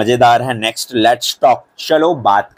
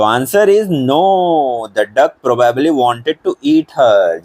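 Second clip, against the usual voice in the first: English answer read word by word; very slow and clear; teacher dictating for notebooks; slow dictation; much slower than usual. Shown as a demonstration.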